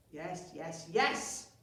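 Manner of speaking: impatient chief snapping yes yes yes; snapped. Acted. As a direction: cut him off, fed up. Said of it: "snapped Chief Cheesy Feet".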